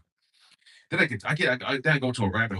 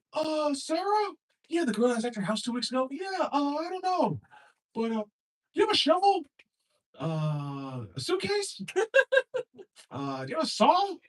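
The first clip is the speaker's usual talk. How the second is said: much higher, halting innocent voice; clueless killer. Acted. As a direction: play dumb in a squeaky voice. Acted clueless killer neighbor.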